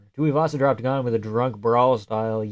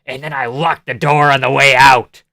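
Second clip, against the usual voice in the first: mock jump scare; 2 semitones higher, 11 dB louder, slower than usual. Creepy story ends in a jump scare.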